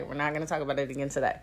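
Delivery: hushed, hurried restatement; muted repeat. Real, not acted. Repeats refusal to herself, much softer.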